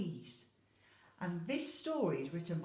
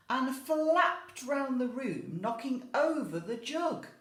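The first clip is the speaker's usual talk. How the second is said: louder, livelier reading; lively narration; 9 semitones higher, 10 dB louder, slower than usual. A narrator's reading. Teacher reading the hen's mess, excited louder telling. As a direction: bring the chaos to life. Hen flapping round the room.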